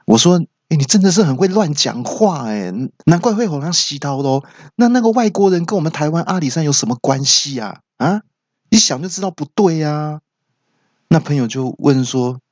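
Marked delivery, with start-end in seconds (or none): 0.7-10.2 raised scolding. Retold or imitated. Scolding friend's nonsense story.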